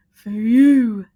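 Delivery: loud gruff dog offering. A narrator's reading. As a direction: present it proudly. Dog gives the bow.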